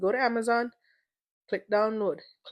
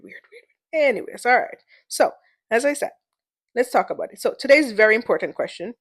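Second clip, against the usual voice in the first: hyped show host; 10 dB louder than usual. Acted. Host answering listener questions in her segment, hyped host voice. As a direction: keep the segment voice big and playful throughout.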